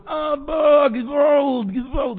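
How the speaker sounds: high pleading cry of "גזבולד". Retold or imitated. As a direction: call out high for help. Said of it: "לצעוק".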